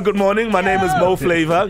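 normal talking voice

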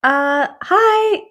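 high, nervous hi through the stall door. Retold or imitated. Scared woman in the men's stall calling out to the man. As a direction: play it jittery and way too polite to a stranger. Scared, addressing stranger in toilet.

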